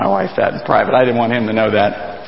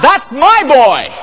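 high, slow proud father's shout; proud cheer; 13 semitones higher, 3 dB louder, slower than usual. Retold or imitated. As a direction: cheer your son from the stands. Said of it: "Dad cries out".

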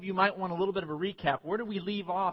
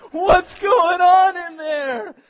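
high, drawn-out panicked shout; imagined alarmed kids; 12 semitones higher, 15 dB louder, much slower than usual. Retold or imitated. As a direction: wail it loudly like frightened children. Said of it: "picture the little kids out in the office going".

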